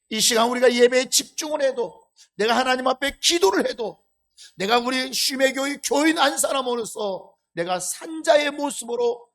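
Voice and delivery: 높고 크게 외치는 설교조; 회중을 향해 힘주어 선포하듯. Acted. (목청 높여 강하게 설교하기)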